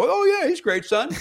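put-on high, forced enthusiasm for the kid; feigned agreement. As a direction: humour your son with a fake high-pitched yes. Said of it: "me having to go".

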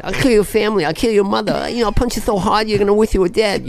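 Mike Tyson impression. Tyson threatening his opponent before the fight. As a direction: menace in a high Tyson voice. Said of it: "Before the fight".

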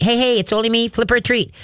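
usual speaking voice

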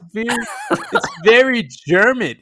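real laughter breaking through the words; laughing. Real, not acted. Co-host laughing about the German-sounding announcer. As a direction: say it while cracking up.

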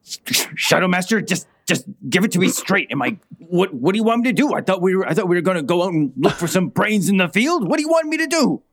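high, pleading desperate voice; pleading Adam. Acted. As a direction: beg for a straight answer, voice climbing. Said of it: "just being like".

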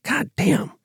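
quoted under the breath, in an odd put-on voice; a dazed, disbelieving curse; stunned "God damn". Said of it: "I was like".

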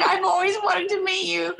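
put-on high, laughing old lady voice; old woman voice. To Spielberg in prosthetics, as an old fan: high old lady voice. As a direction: gush like a frail elderly fan. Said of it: "old woman in wheelchair".

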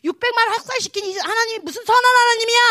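loud, high accusing shout; acted rebellious outburst. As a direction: hurl the question at God in defiance. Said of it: "무기력하다고 막 반항하고 있고".

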